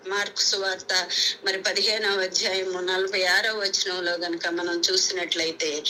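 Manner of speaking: ordinary delivery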